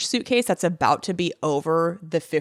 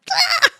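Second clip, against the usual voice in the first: shrieking laugh jumping up high; 4 dB louder than usual. Real, not acted. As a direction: let out a startled squealing laugh. Shark wriggles free.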